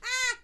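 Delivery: short, high, loud "ah"; sudden yelp. Acted. Comic after the Julio bit, sudden high yelp. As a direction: let out a sharp high cry.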